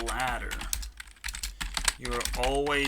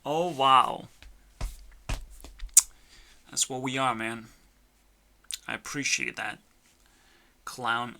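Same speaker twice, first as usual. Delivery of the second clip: usual speaking voice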